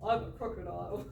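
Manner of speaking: retry the crocodile, laughing. Acted. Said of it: "that wasn't a very good crocodile voice".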